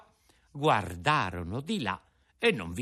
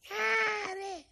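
much higher, very slow wail; echoing cat. Acted. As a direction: stretch the word like a mewing cat. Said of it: "ripeté il gatto".

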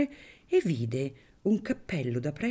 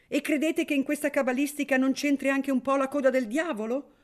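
a mild mock shout, higher in pitch; a guest's light mock shout; 10 semitones higher, faster than usual. A narrator's reading. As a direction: call it out across the table, half-joking. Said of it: "gridò qualcuno".

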